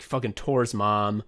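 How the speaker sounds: usual speaking voice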